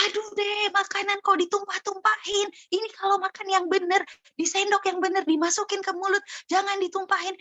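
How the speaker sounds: high-pitched, exasperated nagging; angry mother scolding. Acted. Mother scolds child for spilling food, acted harsh anger. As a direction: nag crossly in a sustained high voice. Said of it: "ibunya lagi marah dengan intonasi kasar".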